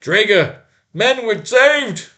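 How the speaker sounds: high, slow, elated shout; joyful cry. Acted. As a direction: shout for joy at the rescue. Rescued man rejoices.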